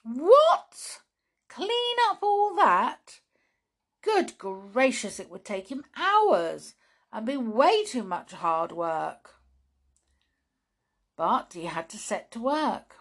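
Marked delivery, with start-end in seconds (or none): 0.0-9.4 high, loud shocked cry; dismayed outburst. Acted. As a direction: recoil at the huge mess. Woski dismayed at mess.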